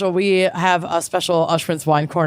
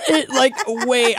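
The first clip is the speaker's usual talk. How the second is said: laughing; 6 semitones higher than usual. Real, not acted. Laughs at her own notes.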